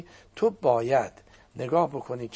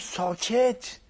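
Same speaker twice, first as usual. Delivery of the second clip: retold shout; 6 semitones higher, slower than usual. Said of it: "سرم داد زد".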